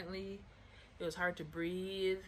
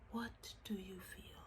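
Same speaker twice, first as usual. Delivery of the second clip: breathy mock-serious whisper, much softer; mock dramatic whisper; 8 dB quieter than usual. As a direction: whisper it like a cheesy therapist.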